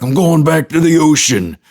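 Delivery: high strained parody of the mariner; Kevin Costner. Acted. Freaked-out mariner leaving land, hammed-up Costner voice. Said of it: "and then he's like".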